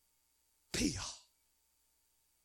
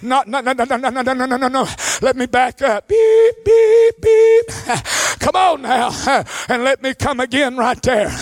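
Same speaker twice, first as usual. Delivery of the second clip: ordinary delivery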